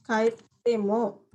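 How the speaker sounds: usual speaking voice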